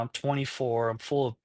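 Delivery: ordinary talk, nothing marked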